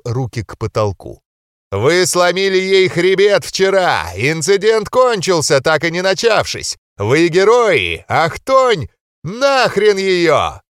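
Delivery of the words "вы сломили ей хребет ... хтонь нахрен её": громкий, протяжный анонс на весь зал; ведущий боксёрского ринга. A narrator's reading. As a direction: raise the crowd like a ring announcer. Referee shouts to crowd. (ведущий на ринге заводит толпу, громко и протяжно)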